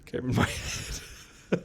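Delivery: slow, halting impression; Obama. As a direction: draw it out and lift the ending. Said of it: "Now I talk like Obama".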